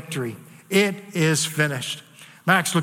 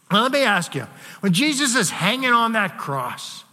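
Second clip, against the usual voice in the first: ordinary talk, nothing marked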